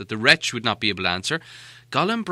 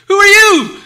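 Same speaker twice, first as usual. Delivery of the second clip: much higher, louder startled cry; startled hobbit; 18 dB louder than usual. Acted. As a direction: jump out of your skin and blurt it. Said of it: "said Bilbo".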